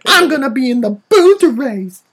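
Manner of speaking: put-on crank it way up like a wide-eyed little boy; hammed-up child Anakin gushing at a girl; Anakin Skywalker voice. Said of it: "And then Anakin".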